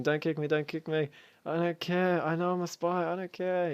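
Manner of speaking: high-pitched panicked pleading, mocked up; imitating a screaming spy. Spy begs the council not to kick him, high mock scream. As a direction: squeal it like a desperate spy begging not to be kicked. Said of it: "he started screaming".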